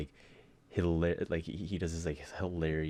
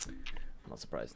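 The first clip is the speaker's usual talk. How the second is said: whisper; 6 semitones lower, 5 dB quieter, slower than usual. Real, not acted. Deadpan aside after heinous messages.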